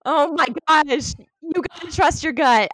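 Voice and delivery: high, loud exclaiming; excited teasing. Real, not acted. Host teasing her guest after the miss, high and loud. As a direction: tease her for missing it.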